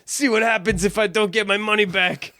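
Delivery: threatening thug voice. Retold or imitated. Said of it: "they threaten him".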